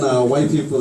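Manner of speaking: ordinary delivery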